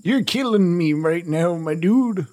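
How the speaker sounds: usual speaking voice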